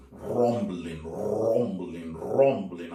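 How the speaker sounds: growling storm voice; rumbling thunder. Acted. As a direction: make the words roll like thunder. Thunder approaching in the story.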